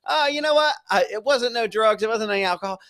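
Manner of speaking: high-pitched incredulous mimicry; exaggerated high voice for a ridiculous claim; mock confession. Mocking an unlikely confession.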